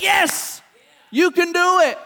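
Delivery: high, loud cheer of encouragement; excited cheering. Acted. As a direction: cheer them on from the sidelines. God cheering a step on.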